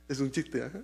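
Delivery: ordinary delivery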